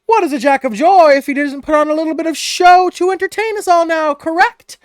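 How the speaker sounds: high, showy ringmaster voice; Mister Light asking Grevin to perform for the crowd, with flourish. Acted. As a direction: invite the star performer with grand courtesy. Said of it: "he says".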